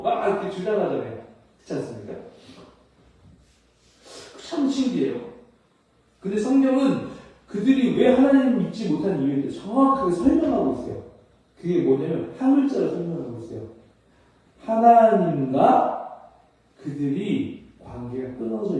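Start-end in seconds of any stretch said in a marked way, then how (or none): none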